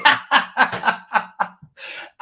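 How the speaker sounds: unvoiced high cackle of laughter; real helpless laugh; hollering with laughter; breathy high laugh. Real, not acted. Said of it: "I was hollering".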